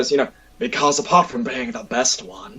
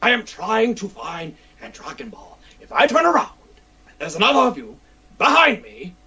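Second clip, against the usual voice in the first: higher, exasperated Vegeta voice; irritated Vegeta; 9 semitones higher than usual. Acted. Vegeta cosplayer scolds fans trailing him, exasperated rant. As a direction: scold the cretins following you. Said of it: "and I'm like".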